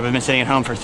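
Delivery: ordinary talk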